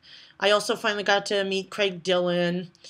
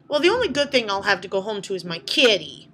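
ordinary delivery

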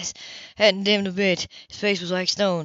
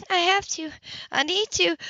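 higher, urgent woman's plea; pleading woman; 10 semitones higher than usual. Acted. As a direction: beg him to let you stay. Grace pleads with Ethan.

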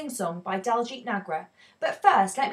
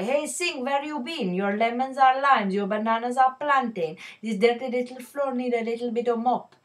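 put-on Indian accent; 3 semitones higher, 3 dB louder than usual. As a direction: call out like teasing customers, Indian accent. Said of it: "I'm going to have to don an Indian accent".

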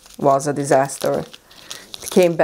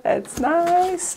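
high delighted coo; 12 semitones higher than usual. Real, not acted. Delighted over rare gift.

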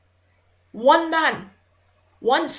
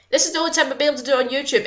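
ordinary talk

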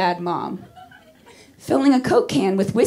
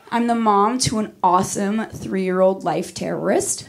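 ordinary talk

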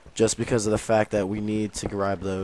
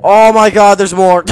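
high, loud burst of amazement; thrilled shriek; 10 semitones higher, 16 dB louder than usual. Real, not acted. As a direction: shout it high, overjoyed at the find. Discovers more diamonds.

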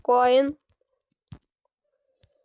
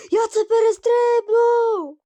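high, loud triumphant yell; slow, stretched cry of triumph; excited shout; 9 semitones higher, 6 dB louder, slower than usual. Real, not acted. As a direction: shout it out in victory. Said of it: "triumphant repeat after jump".

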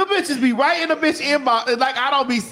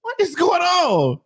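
high, slow, shouted bewilderment; 7 semitones higher, slower than usual. Retold or imitated. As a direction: demand it into the mic. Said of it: "she was like".

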